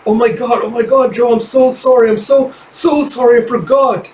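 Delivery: mock-panicked high apology; frantic apology. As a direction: gush sorry in a rush of alarm. Forever apologizing after slamming Joe.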